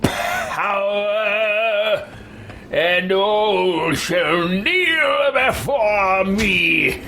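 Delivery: slow, booming mock tyrant; evil villain shout. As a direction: drag out the words like a power-mad sorcerer.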